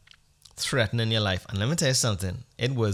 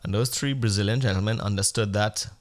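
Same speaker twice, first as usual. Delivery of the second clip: usual speaking voice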